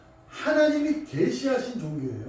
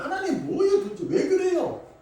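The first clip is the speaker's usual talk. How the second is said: Job's desperate plea; 9 semitones higher than usual. Acted. Job cries out to God.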